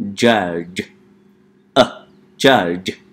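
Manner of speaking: judge said on its own, a clear first syllable of judgmental. Shown as a demonstration. Teacher breaks judgmental into syllables for the class. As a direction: isolate judge before the full word. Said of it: "we need to say judge".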